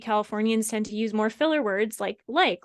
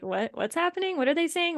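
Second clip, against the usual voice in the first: confused reader; 7 semitones higher than usual. Retold or imitated. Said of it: "You're not necessarily gonna be like".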